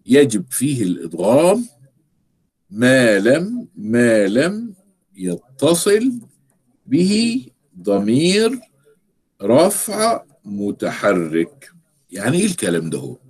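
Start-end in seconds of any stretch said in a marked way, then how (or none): 0.0-11.7 slow, word by word, the high peaks landing on يتصل; slow dictation. Shown as a demonstration. Teacher dictating a grammar rule for students to copy down. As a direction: dictate it slowly as if writing on the board. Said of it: "اكتبوا معايا".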